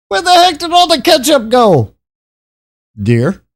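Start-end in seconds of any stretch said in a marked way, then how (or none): 0.1-2.0 exasperated outcry. Real, not acted. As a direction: shout at the missing ketchup. Ketchup all gone, exclaiming.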